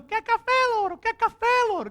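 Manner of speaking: high, loud squawking parrot call. Retold or imitated. Preacher imitating grandma's parrot calling out, high squawk. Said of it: "ele gritando".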